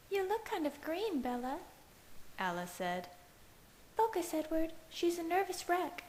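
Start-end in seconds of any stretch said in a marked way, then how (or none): 0.1-1.6 put-on much higher teasing girl's voice; playful concern; Alice's voice. A narrator's reading. Alice teases Bella. 4.0-6.0 put-on much higher urging girl's voice; Alice's voice. A narrator's reading. Alice scolds Edward.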